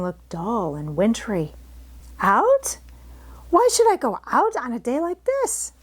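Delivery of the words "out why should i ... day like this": high, disbelieving protest; indignant child. Acted. Mary refuses to go outside, high indignant protest. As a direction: balk at going out.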